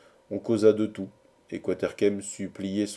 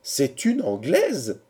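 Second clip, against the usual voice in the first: higher, slow, drawn-out cry; astonished exclamation; 5 semitones higher, 3 dB louder, much slower than usual. A narrator's reading. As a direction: exclaim it slowly as one shocked crowd. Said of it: "s'écria-t-il tout d'une voix". (exclamation étonnée et traînante)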